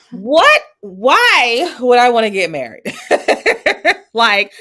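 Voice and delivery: host laughing through her question. Real, not acted. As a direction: burst out laughing as you ask it. Host cracks up asking question.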